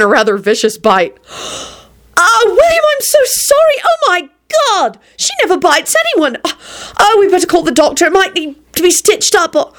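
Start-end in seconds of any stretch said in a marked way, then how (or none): 2.2-9.7 very high, flustered gush; frantic apology. A narrator's reading. Rosie to bitten William, high and frantic with apology. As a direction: panic in a high-pitched voice, words tumbling.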